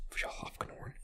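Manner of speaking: breathy whispered repeat; hushed murmur of the name. Real, not acted.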